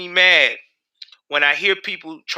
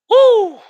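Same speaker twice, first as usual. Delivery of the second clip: loud high whoop of triumph; joyful whoop; 8 dB louder than usual. Real, not acted. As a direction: let out a big celebratory whoop. Cheer after rant.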